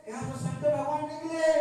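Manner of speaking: acted villager's excitement; excited proclamation. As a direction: announce the find to the village. Villagers proclaim their discovery.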